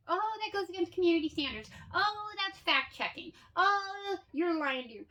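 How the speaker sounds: mock prissy warning voice; mocking Facebook. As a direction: parody the fact-check notices.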